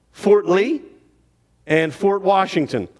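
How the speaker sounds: ordinary delivery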